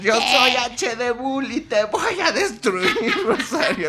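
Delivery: high, slow laughing villain threat; hammed-up villain vowing destruction; mock villain. Mock villain duel reply.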